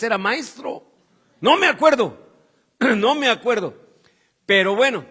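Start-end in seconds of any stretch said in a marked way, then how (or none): 1.4-3.7 high, loud mock disclaimer; joking protest. Preacher joking about his student days long ago, mock protesting. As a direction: protest loudly, playing it for laughs.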